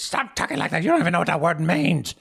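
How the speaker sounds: high, loud outburst; irate Herb, accusing. Acted. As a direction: shout the accusation at a rival. Herb snaps back.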